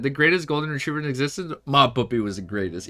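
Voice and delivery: ordinary delivery, nothing marked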